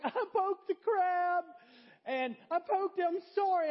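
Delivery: put-on high, loud, drawn-out child's bawling; mock tearful wail. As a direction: confess like a sobbing second-grader, hammed-up. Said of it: "I just start breaking down in tears".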